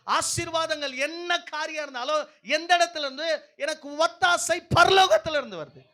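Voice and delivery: loud, high, ringing declaration to the congregation; full-force preaching. Acted. Preacher declaring to the congregation where help comes from, full-force preaching.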